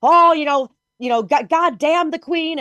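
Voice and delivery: mocking Americans cursing the Queen; mock anti-Queen rant. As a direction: ham up a fake Irish grievance. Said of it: "being like".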